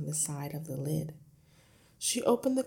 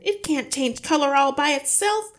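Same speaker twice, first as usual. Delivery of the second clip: high tirade climbing to a screech; scornful wife, loud and very high; outraged cry; 12 dB louder than usual. A narrator's reading. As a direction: scold your husband, getting more piercing. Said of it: "the mother cried".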